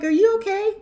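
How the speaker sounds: high-pitched mock concern; mock-concerned question; incredulous. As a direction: ask it high, amused and disbelieving. Imagined reply to an insult.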